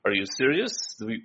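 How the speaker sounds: ordinary talk, nothing marked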